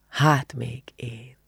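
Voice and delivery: very slow, soft murmur, dying away. A narrator's reading. Attila repeats his answer before letting her go, murmured. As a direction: stretch each word and let it fade. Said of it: "mormolta Attila".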